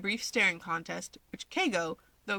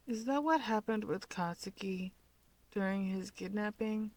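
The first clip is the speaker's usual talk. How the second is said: softer, a light sleepy mumble; mumbled; 4 semitones higher, 5 dB quieter than usual. A narrator's reading. Sleepy Shoto teasing his brother, soft mumble. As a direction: mumble it half under your breath. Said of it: "Shoto mumbles".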